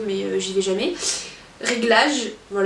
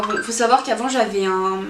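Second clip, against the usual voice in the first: ordinary talk, nothing marked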